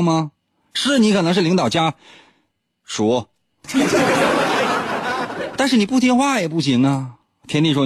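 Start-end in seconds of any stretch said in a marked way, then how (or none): 3.7-5.5 airy giggle; breathy high laugh. Real, not acted. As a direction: silent wheezing laugh. Laughs at own joke.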